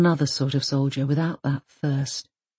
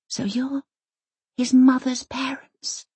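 whispering; 7 semitones higher than usual. Acted. Elsa asks, shocked, whispering.

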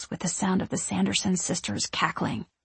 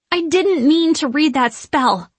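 slow, higher, loud forced bravado; confident and dangerous; 8 semitones higher, 6 dB louder than usual. A narrator's reading. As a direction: try to sound dangerous. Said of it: "sound confident and a bit dangerous".

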